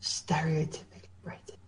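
barely voiced whisper; murmured under the breath, pondering; musing to himself. Real, not acted. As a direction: whisper it to yourself while thinking. Thinking the question over to himself.

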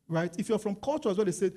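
ordinary talk

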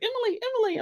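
high calling "Emily, Emily"; mimicking a yell. Imitating the neighbor yelling out her window, high. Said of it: "just like".

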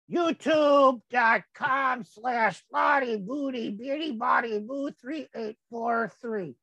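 careful address dictation; slow spelled address. Shown as a demonstration. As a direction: read the address out slowly and clearly. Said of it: "spelling out web address".

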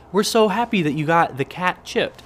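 mock cheery company email; chirpy email voice. Vet clinic email thanking him, read in a mock cheery voice. Reading the chip confirmation email.

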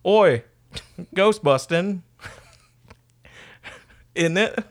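high, loud mock-British shout; badly faked British accent, breaking into laughter. Shown as a demonstration. Podcaster shows off his terrible British accent. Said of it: "my British accent".

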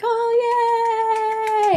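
high sing-song squeal of joy; mock cheerleader cheer. Cheering on her husband in her head, squealing high. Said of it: "you were my biggest cheerleader".

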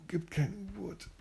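ordinary delivery

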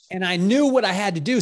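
ordinary talk

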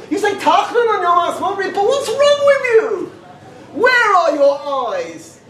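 high, slow incredulous scolding; high, slow incredulous rebuke; exasperated. Retold or imitated. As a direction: rebuke the shul in disbelief. Outraged rebuke.

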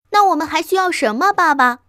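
put-on high, loud, quick child's question; eager fox child. A narrator's reading. Little fox asking dad what they need, eager.

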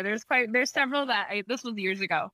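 usual speaking voice

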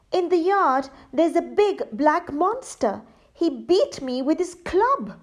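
high scared robber telling; frightened retelling. Acted. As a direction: still shaking from the attack. Robber tells his terrifying escape.

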